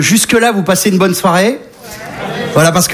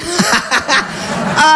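high laugh; 8 semitones higher, 3 dB quieter than usual. Acted. Bear cackling at his joke.

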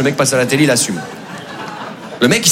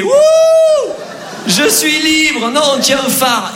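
ecstatic Mikey; 12 semitones higher, much slower than usual. Retold or imitated. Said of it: "Mikey faisait".

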